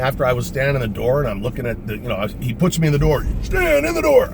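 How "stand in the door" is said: high, loud jumpmaster yell; shouted order. Retold or imitated. Jumpmaster ordering him into the door, shouted command.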